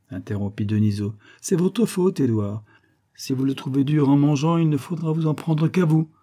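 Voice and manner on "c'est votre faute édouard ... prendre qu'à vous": scolding. A narrator's reading. Friend blames colonel for burnt liver.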